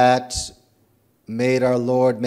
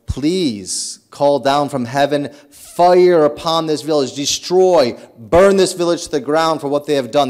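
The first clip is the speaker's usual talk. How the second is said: louder, higher plea for fire from heaven; angry demand; 4 semitones higher, 7 dB louder than usual. Retold or imitated. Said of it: "And they get very angry".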